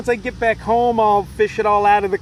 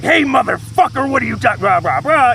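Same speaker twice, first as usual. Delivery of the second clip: Batman voice; 5 semitones higher, 6 dB louder than usual. Retold or imitated. Hiker confronting Michael at the shelter, mock Batman growl. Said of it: "just dropped Batman voice on him".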